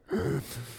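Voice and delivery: a sharp gasp caught mid-breath, imitated; retold gasp. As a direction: gasp as if about to break down. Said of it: "I'm just like".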